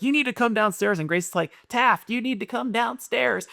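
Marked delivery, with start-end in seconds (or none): 0.0-1.0 higher-pitched stern mom; imitated scolding mom. Mom disciplining her son from downstairs, imitated sternness. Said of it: "so Whitney's like". 1.7-3.5 high, drawn-out little-girl mock yell; echoing little sister. Little sister echoing mom at her brother, mock yelling. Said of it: "she's yelling at Taft right behind Whitney".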